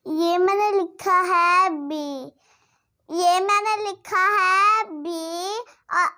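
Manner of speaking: normal talking voice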